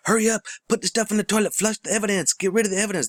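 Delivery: high, fast panicked voice; frantic mock order. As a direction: play the boss hissing orders in a rush. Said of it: "they tell 'em".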